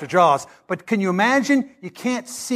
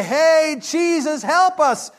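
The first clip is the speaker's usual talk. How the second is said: panicked plea; 8 semitones higher, much slower than usual. Retold or imitated. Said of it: "they're freaking out".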